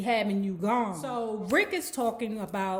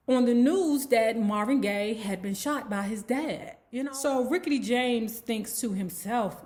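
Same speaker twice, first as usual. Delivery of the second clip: usual speaking voice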